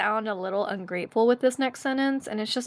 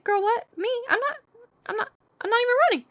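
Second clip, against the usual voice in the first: high, stammering mock indignation; rapid, pitched-up defensive retort; incredulous protest; 13 semitones higher, much faster than usual. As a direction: sputter in disbelief at being accused. Acts out protest to security lady.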